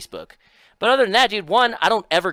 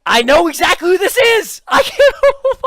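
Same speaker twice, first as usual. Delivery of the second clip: excited; 12 semitones higher, 9 dB louder than usual. Real, not acted. Recognizes the guy, laughing loud.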